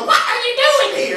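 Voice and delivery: high, loud rooster screech as words. Retold or imitated. Singer voices the roosters, high screechy squawk. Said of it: "That's what it sounded like".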